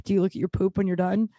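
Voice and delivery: usual speaking voice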